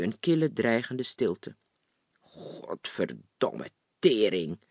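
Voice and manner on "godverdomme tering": low, drawn-out angry swearing; seething oath, pitched low and very slow; heartfelt cursing. A narrator's reading. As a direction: curse slowly from the gut, stretching each word out. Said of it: "vloekte ze hartgrondig".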